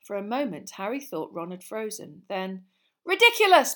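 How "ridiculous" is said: loud, high bellow; big shouted incantation; bellowed spell. A narrator's reading. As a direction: bellow it with everything you have. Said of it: "bellowed Ron".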